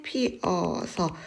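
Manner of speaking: 피어서 broken into 피-어-서; clashing vowels separated. Shown as a demonstration. Teacher showing a vowel clash, 피어서 said as separate syllables. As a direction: say 피어서 syllable by syllable, keeping both vowels apart. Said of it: "모음이 충돌하는".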